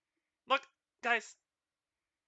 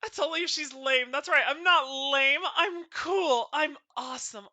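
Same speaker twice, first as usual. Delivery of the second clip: mock bragging; 5 semitones higher, 6 dB louder than usual. Boasting she's not lame.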